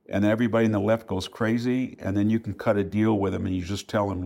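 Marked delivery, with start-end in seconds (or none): none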